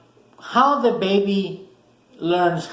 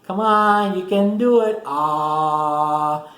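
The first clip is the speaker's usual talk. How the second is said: put-on coaxing parent feeding baby; 3 semitones higher, much slower than usual. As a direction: draw the words out to lure a baby's mouth open, ending on a sung "ah". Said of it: "So mom is going, or dad's going".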